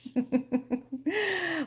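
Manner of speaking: light, quiet chuckle; soft laugh. Real, not acted. Reader laughing after the story, soft chuckle. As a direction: laugh softly at the end.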